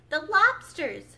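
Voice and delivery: loud, high, drawn-out exclamation; shouting. A narrator's reading. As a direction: shout "lobsters" with a falling finish. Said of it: "shouted the Gryphon".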